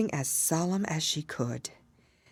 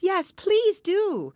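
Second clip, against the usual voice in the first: much higher, louder, slow pleading chorus; chorus of creatures; 6 dB louder, much slower than usual. A narrator's reading. The animals beg the mouse to return, slow high chorus. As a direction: plead together, drawn out. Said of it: "the others all joined in chorus".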